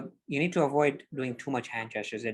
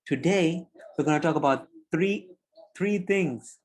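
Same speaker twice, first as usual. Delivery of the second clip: higher, louder, fast and energetic; big animated presenter; 4 semitones higher, 4 dB louder than usual. Shown as a demonstration. Coach demos big gestures, energetic presenter. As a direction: open big like you own the room. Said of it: "I'm taking up the screen".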